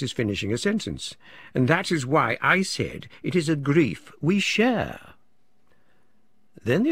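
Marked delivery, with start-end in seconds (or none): 1.5-5.1 emphatic, higher-pitched declaiming; a touch grandiose, driving each phrase home; earnest acquaintance. A narrator's reading. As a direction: make a heartfelt point, pitched up. Acquaintance's speech voiced in narration.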